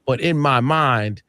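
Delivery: usual speaking voice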